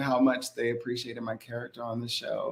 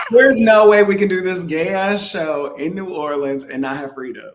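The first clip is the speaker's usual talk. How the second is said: loud, high-pitched, laughing insistence; emphatic plea; 5 semitones higher, 8 dB louder than usual. Retold or imitated. Pleading with [name] to get [name] on the show, loud emphatic plea. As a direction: burst out with an impassioned demand, half laughing.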